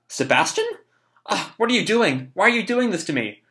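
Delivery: put-on high, plaintive child character; voiced distressed boy. Boy asks Sebastian why he is tugging, distressed boy.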